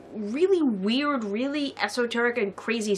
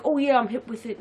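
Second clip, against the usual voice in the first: put-on London accent. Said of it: "like, the, like".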